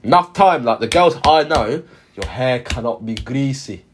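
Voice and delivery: usual speaking voice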